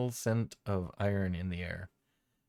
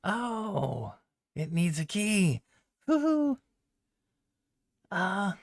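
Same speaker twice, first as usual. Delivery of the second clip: much higher, squeaky goblin voice; 11 semitones higher, 4 dB louder, slower than usual. Acted. Jingle Jangle inspecting the cage, high and delighted. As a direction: peer at the lock with a delighted squeak.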